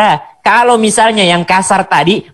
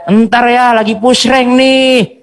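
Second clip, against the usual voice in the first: higher-pitched drawn-out "later"; putting off dad; 7 semitones higher, slower than usual. Retold or imitated. Gamer child called by father, putting him off. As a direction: stall in a raised, dragging voice.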